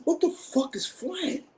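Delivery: high, slow, baffled question; mocking his own confusion at the sales pitch; incredulous, drawn out. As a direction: stretch it out in disbelief, way up high. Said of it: "I said".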